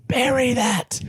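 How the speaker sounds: high-pitched, excited call at the screen; retold urging cry. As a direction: re-enact a fan urging the striker on. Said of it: "I was going".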